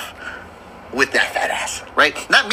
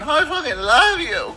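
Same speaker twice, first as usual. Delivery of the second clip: high, slow mock sob; imitating crying; 8 semitones higher, slower than usual. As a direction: wail it slowly in pretend tears. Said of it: "Crying, crying".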